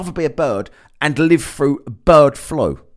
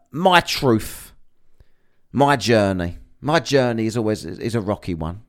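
lower, softer, lightly posh mock of [name]; 7 semitones lower, 5 dB quieter than usual. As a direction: sigh out a self-pitying celebrity line. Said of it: "starts talking about shit like".

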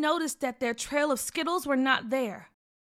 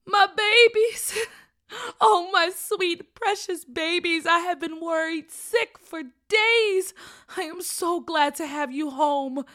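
high, slow, emotional outpouring; tearful relief; 5 semitones higher, 6 dB louder than usual. A narrator's reading. Dad reunited with missing children, tearfully relieved. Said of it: "said with tears in their eyes".

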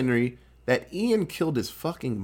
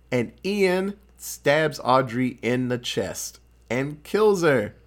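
ordinary talk

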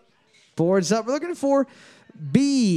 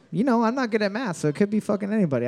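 usual speaking voice

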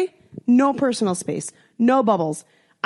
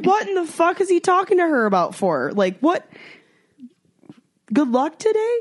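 high, baffled what-the-hell; disbelieving, pitched up; incredulous bystander; 9 semitones higher than usual. Retold or imitated. A bystander baffled by his odd greeting, high and incredulous. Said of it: "anybody else would be like".

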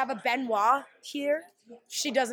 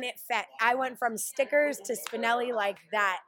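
ordinary delivery

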